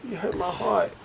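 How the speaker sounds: put-on high, soft hurt little kid; mock heartbroken kid. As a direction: whimper it like a hurt child. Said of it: "I wanted to say that shit".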